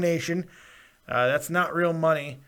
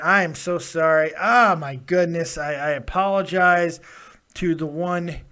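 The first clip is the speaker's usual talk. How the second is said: ordinary delivery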